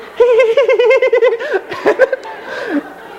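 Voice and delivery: high, frantic giggling cackle; Joker giggle. Retold or imitated. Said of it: "[name] is sounding like the Joker".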